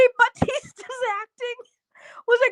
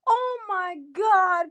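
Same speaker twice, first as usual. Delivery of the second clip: usual speaking voice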